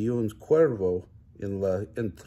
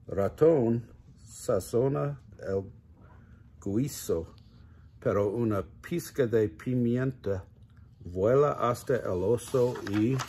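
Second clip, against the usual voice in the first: plain reading voice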